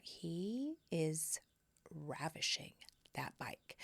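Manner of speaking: hushed, lingering admiration; very soft, awed aside; smitten private thought. Acted. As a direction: murmur it slowly to yourself, with pauses.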